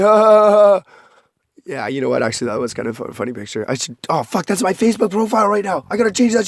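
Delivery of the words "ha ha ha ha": forced, hammed-up laugh; ha ha like you're pretending to be happy; fake laugh. Mocking his old photo, a fake happy laugh. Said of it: "pretend to be happy and funny".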